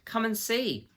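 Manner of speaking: high, bright call almost dancing; delighted cry. A narrator's reading. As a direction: cry out with bubbling joy. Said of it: "cried Mister Beaver, who was almost dancing with delight".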